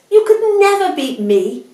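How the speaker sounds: high, loud scornful boast; irritated. Acted. Hare dismisses Tortoise's challenge, high irritated boast. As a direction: throw it out high and loud, affronted. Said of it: "his whiskers twitching in irritation".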